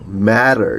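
"Matter" with a flapped middle t, "madder"; American flap in "Matter". Shown as a demonstration. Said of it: "플랫빙이 된다고".

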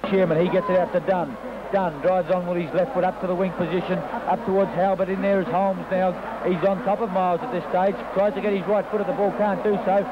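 ordinary talk

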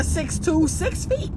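six feet rising to a high mocking squeal; a squeaky, mocking whine. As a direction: climb up into a mock wail. Mocking shrinking height demands.

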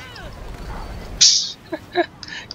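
long, breathy wheeze of laughter; wheezing laugh, mostly breath. Real, not acted. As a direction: crack up helplessly. Laughing at own game.

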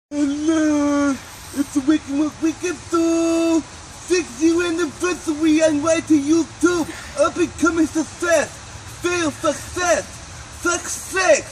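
high, climbing hype into a shouted success; hyped host. Acted. Host hypes his anniversary show, high and shouting. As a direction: hype the show up to a party yell.

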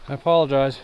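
panting hard while talking; winded from the climb; out of breath. Real, not acted. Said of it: "me panting like an old man".

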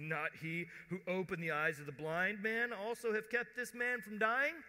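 rising, loud challenge; accusing. Retold or imitated. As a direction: build to a high, indignant question. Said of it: "Heaping accusation on him".

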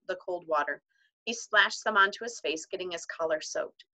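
ordinary delivery, nothing marked

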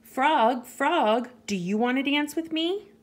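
high, eager mouse invitation; little mouse calling. A narrator's reading. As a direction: call the animal, then lift into the question. Mouse calls to an animal.

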